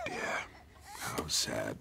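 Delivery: soft, low, tearful words; quietly mourning, close to crying; sad sigh. Acted. Mourning the dead snail.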